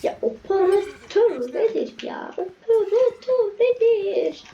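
high, slow, laughing disbelief. Real, not acted. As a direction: ask it half laughing, baffled. Baffled by the operator weapon.

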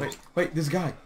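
loud, high urgent warning; alarmed shout. Real, not acted. Spots enemy during gunfight.

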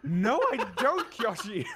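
high laughing protest; laughing denial. Real, not acted. Streamer laughs off chat's Snape comparison.

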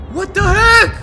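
loud, high burst of disbelief; alarmed, high-pitched outcry; startled yell. Real, not acted. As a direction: shout it in sudden shock. Startled by missing teddy.

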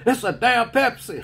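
mock yell. Drill sergeant answering his own quiz, mock shout. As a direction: bark the answer at the private. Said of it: "he said".

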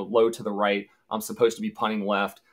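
usual speaking voice

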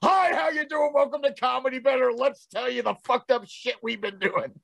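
high, brisk show-opener patter; mock host greeting. Mocking how they launched into the panel unintroduced, mock host greeting. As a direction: launch into a hammed-up welcome spiel. Parodies their skipped panel introduction.

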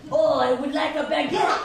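mock French accent on English; hammed-up Frenchman ordering bread; playful fake French accent. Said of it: "French accent class".

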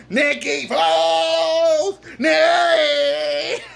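high, drawn-out fan cheer; cheering shout. Real, not acted. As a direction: holler the hero's name, stretching it out. Cheering the quarterback by name.

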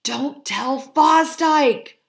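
slow, high fretful fuss. A narrator's reading. Fred frets to the foxes about Fosdyke, slowly. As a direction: fret over it, drawing it out. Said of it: "fussed Fred".

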